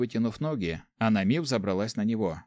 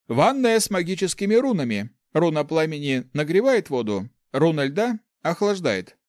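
high, louder servant voice; servant explaining; 6 semitones higher, 5 dB louder than usual. A narrator's reading. Servant explains the magic bath to guests, high-pitched and presentational.

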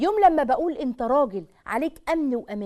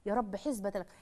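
ordinary talk

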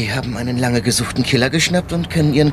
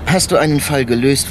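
ordinary talk, nothing marked

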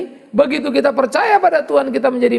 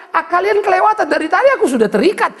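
mocking devil; 7 semitones higher than usual. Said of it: "Setan kan ketawa terus bilang".

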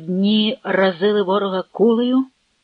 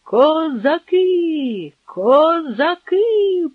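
high, slow, drawn alarm; fleeing soldiers cry out in panic; panicked crowd cry, high and slow; 8 semitones higher, much slower than usual. Acted. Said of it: "панічно загуло".

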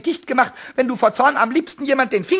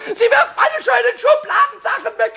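higher, loud, half-crying shriek of outrage; indignant shriek; 9 semitones higher, 3 dB louder than usual. Acted. Pumuckl complaining to Eder about his things, shrill outcry. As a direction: howl that everything is his.

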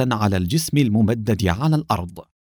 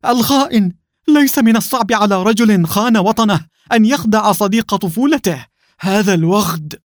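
high, loud furious shout; feigned fury; 11 semitones higher, 5 dB louder than usual. Acted. Adham faking rage at the traitor, shouting high and loud. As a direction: roar the betrayal in a high voice. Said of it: "صاح أدهم متظاهرا بالغضب".

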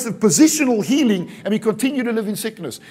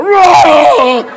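very loud, high acted roar; furious roar; 6 semitones higher, 13 dB louder than usual. Husband bursting through the front door, roaring. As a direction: explode in a hammed-up roar of anger. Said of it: "a fully fledged roaring argument".

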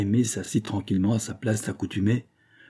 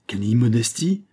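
outraged cry. A narrator's reading. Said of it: "s'écria Victoire, outrée".

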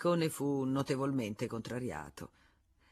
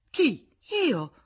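high, loud disbelief; incredulous; 10 semitones higher, 8 dB louder than usual. Acted. Federico shocked at being thrown out, high and loud. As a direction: ask in shock.

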